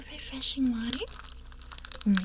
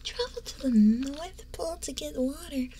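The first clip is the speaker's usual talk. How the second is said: ASMR whisper; 4 semitones higher, 5 dB quieter than usual. Acted.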